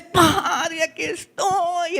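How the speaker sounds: high, drawn-out acted cry to God; re-enacted calling out in prayer; prayerful clamor. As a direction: lift the voice in a long plea. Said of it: "empieza un clamor".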